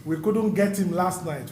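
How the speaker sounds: ordinary talk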